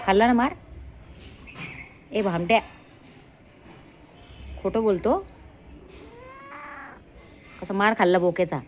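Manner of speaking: loud teasing scold of the dog; mock scolding. Real, not acted. Owner mock-scolding her dog for lying. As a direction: tell him off playfully, loudly.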